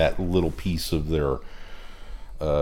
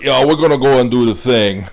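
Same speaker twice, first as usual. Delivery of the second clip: louder mock South African robot accent; bad South African accent; 5 semitones higher, 8 dB louder than usual. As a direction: ham up the Croton's clumsy South African accent. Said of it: "his accent is so atrocious".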